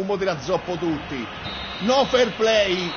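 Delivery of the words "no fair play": slow, loud, higher taunt; taunting shout. Real, not acted. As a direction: drag out the shout to mock the loser. Taunting the opponent.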